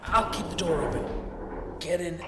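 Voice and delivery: loud, pushed out through the effort; straining. Acted. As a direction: give orders while lifting hard. Holding the heavy door open.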